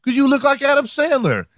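very high put-on woman's voice; imitated woman coworker.

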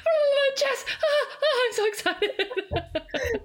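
high, loud squealing fangirl; starstruck fangirl. Retold or imitated. Host gushing at Jess, high squealing fangirl. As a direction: squeal it out, overwhelmed by the star. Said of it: "'cause I was just like".

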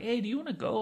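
casual offer to a friend; kinda casual. Retold or imitated. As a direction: toss it off casually, like it's no big deal. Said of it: "Like, kinda casual".

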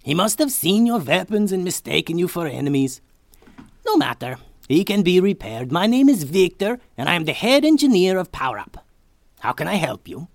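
high, loud excitable scientist; eccentric inventor. A narrator's reading. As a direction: ramble like a proud inventor. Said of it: "Victor introduces himself".

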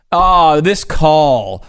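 slow, drawn-out mock complaint; stretched-out, exaggerated griping; mock sore loser. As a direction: lightly play a moaning losing athlete. Mock quote of losing player.

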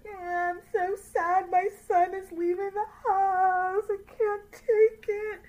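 high, slow, hammed-up sob of a mother; mock crying mother. Mocking the mother over her son leaving, hammed-up crying. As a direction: wail it like an overdramatic mother losing her son. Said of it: "[name] making the ugly face, like".

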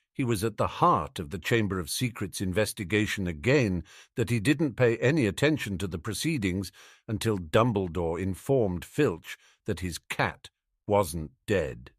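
plain reading voice

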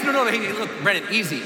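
low, soothing coaxing voice; calming down. Retold or imitated. Instructor calming panicking Brennan in the plane, low and soothing. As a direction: settle him down gently.

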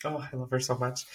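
almost crying with love; speaking of mom, softly moved; choked up. Real, not acted. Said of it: "I could literally cry".

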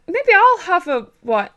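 lightly voiced hesitant boyfriend; timid request. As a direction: mimic a shy boyfriend ordering. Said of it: "he was like".

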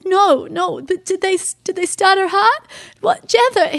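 high, climbing, frantic questions; urgent alarm. Retold or imitated. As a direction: plead for news, pitch rising sharply. Urgent questions about wife's heart.

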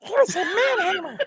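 very high squeaky puppet voice, giggling; Elmo voice. Retold or imitated. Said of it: "how would Elmo say Manhammer".

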